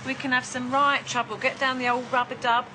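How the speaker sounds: put-on London accent. Presenter pitching himself as Paris's best mate, London accent. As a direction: chat her up hard in a broad London accent. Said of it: "couldn't understand those accents".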